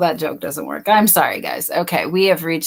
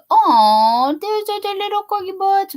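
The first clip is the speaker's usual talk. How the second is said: high cooing voice; 12 semitones higher, 3 dB louder than usual. Real, not acted. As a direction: coo at the cute dog. Cooing at corgi on screen.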